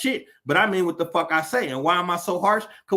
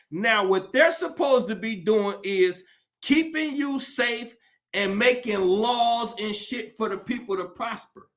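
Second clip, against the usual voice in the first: ordinary talk, nothing marked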